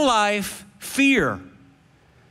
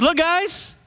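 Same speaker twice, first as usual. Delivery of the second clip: higher, urgent appeal to friends; Jesus urging his disciples, higher and eager; 6 semitones higher than usual. Acted. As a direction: plead with them brightly. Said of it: "He said".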